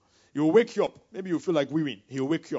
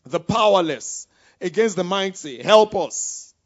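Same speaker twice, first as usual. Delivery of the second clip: loud, imploring cry to the Lord; calling on God; 7 dB louder than usual. Acted. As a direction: read the prayer out as a strong, rising plea. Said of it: "Then Asa called to the Lord".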